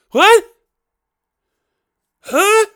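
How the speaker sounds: high, loud incredulous What; baffled outburst. Retold or imitated. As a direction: yell it in comic disbelief. Baffled reaction to heckler.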